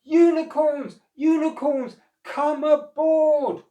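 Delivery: high, loud chorus call, stretched aboard; animals shouting. A narrator's reading. All the animals shouting to the unicorns, high and loud. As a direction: bellow across the flood. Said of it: "shouted till the wet world echoed".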